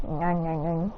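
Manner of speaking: low quiet munching nyang; chewing noises. Retold or imitated. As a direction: mumble eating sounds. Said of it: "They're like".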